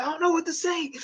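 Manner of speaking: very high, squeaky mock panic; Merce spazzing out. Streamer mimics his friend lost for words with a girl, squeaky panicked falsetto. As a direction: wail helplessly in an imitating falsetto. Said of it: "he starts just spazzing out".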